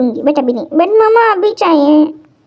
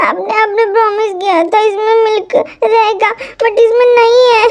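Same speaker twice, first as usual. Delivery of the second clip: put-on crying child; 3 semitones higher than usual. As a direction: sob it out like a little kid denied milk. Child Kookie wails for his milk.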